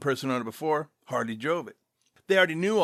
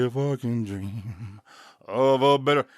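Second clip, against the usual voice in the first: low, slow crooning [name] impression; 4 semitones lower, 3 dB louder, slower than usual. Singing his [name] song to listeners, in a low slow [name] croon. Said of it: "I get back in my [name] voice".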